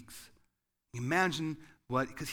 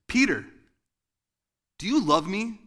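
much higher and louder, an accusing shout; accusing angry father; 7 semitones higher, 10 dB louder than usual. Shown as a demonstration. Angry God accusing Peter by the fire, loud and harsh. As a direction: jab it like a finger in the chest. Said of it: "you would hear it like this".

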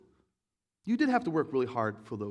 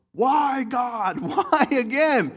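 high, loud, slow cry of why; yelling at God; 8 semitones higher, 10 dB louder, much slower than usual. Retold or imitated. Fan yelling at God after the shanked kick, loud and high. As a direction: wail at the sky after a missed kick. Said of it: "most people yell out".

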